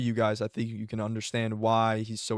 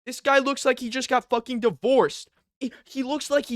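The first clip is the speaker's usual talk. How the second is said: loud ridicule; 12 semitones higher, 6 dB louder than usual. Real, not acted. Comparing old and new Dana.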